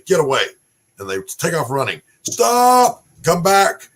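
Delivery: loud barked commands to running recruits; calling out. Retold or imitated. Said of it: "you say".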